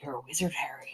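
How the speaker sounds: soft, whispery Scottish giant; half-hearted, very quiet Scottish burr; Scottish accent. Acted. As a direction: attempt Hagrid in a hushed Scottish accent. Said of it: "like, Scottish accent".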